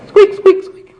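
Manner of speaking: very high, tiny squeaks; mouse squeak. Acted. Turned back into a mouse.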